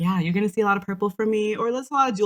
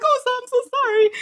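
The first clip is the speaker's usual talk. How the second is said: high flustered apology; mock flustered clerk; frazzled apology; 4 dB louder than usual. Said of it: "they're frazzled".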